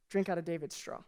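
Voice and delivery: sly hushed whisper. Retold or imitated. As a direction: hush it like a secret dare.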